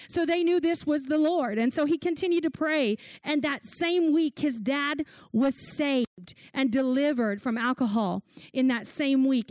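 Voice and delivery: ordinary delivery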